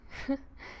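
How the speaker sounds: a short unvoiced chuckle; soft breathy laugh. Captor amused with her captive, soft chuckle. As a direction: laugh quietly to yourself.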